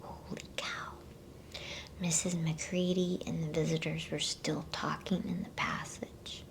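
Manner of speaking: usual speaking voice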